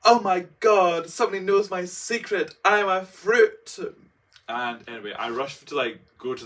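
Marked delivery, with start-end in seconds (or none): 0.0-3.8 loud, high, slow mock panic; hammed-up loud slow panic. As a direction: play the terrified teen being found out.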